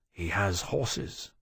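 slow, soft murmur; said low. Acted. Tharkay murmurs to Lawrence, low and slow. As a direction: keep it low and slow, for one ear. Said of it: "said low".

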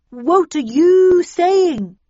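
high, drawn-out demand; loud alarmed question. A narrator's reading. Narrator questioning Snell's theory, high loud demand.

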